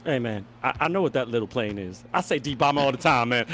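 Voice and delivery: ordinary talk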